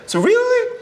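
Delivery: high-pitched, incredulous "So really?"; a director who can't believe his ears; surprised director. Retold or imitated. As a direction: squeak it out in disbelief. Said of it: "He said".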